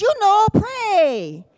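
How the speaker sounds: high, loud, drawn-out spelling-it-out tone; slow, insistent mock scolding; exasperated wife. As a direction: imitate a wife prodding her clueless husband. Wife impatiently explains to husband.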